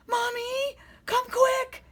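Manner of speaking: high loud call. A narrator's reading. Little monster calls his mommy, high and loud. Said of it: "he called".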